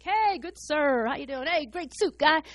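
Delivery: slow, syrupy schmoozing greeting; butter them up like a realtor; flattering salesman. Retold or imitated. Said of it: "businesslike and real flattery and charming".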